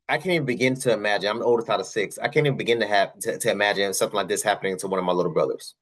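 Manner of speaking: ordinary talk, nothing marked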